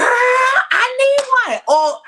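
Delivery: loud, very high outburst; excited shriek. Real, not acted. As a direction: shriek it with real enthusiasm. Excited outburst about Golden Girls item.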